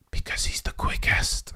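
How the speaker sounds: whispery put-on little boy's voice; imitating young son. Son answers dad on his favourite driver, imitated whispery child voice. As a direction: mimic your kid's answer in a whispered voice. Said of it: "he had one answer".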